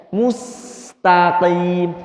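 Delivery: mustaqim drawn out with a plain s sound; slow model of mustaqim; rounded mus. Shown as a demonstration. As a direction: say mustaqim slowly with its s flat, lips back. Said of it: "Kembalikan kepada asal huruf sinnya".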